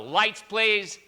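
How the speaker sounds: usual speaking voice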